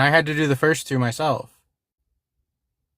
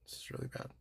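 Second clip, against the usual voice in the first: a near-silent repeat, giving up; despairing whisper; 25 dB quieter, faster than usual. Real, not acted. Streamer to his chat about the bots, whispered in defeat. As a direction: breathe it out under your breath, defeated. Streamer overwhelmed by bot flood.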